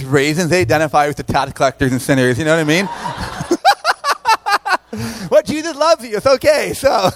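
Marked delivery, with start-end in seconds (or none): 2.9-5.2 bright high burst of laughter. Real, not acted. Camp speaker laughing at his raisin joke, high laugh.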